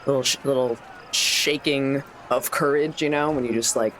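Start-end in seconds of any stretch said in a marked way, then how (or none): none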